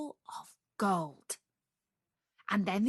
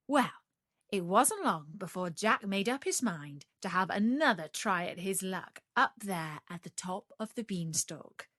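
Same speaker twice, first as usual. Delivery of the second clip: ordinary delivery, nothing marked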